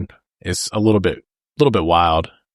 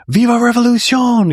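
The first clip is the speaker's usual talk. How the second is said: much higher and louder battle cry; rallying scream; 5 dB louder than usual. Retold or imitated. Bob finding courage to cheer, loud rallying scream. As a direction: scream it with a raised fist. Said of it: "gets the courage to scream".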